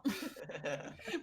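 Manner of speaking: normal talking voice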